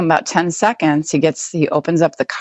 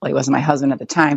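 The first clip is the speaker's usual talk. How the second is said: normal talking voice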